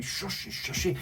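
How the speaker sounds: soft hushed teasing shush; hushed catchphrase. Acted. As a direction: hush it like a secret.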